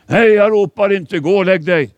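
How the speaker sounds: loud, high, brushing him off; annoyed dismissal. Acted. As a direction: send the boy back to bed, loud and impatient. Priest answers the boy.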